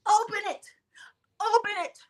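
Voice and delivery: hammed-up shriek of open it; mock yell. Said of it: "who never yells, yelling".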